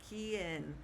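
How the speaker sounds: "quien" as kee-EN, U silent; careful Spanish "quien"; Spanish pronunciation. Shown as a demonstration. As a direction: say "quien" with a K sound, no W. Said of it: "is how we pronounce the word".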